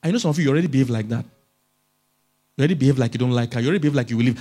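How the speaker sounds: ordinary talk, nothing marked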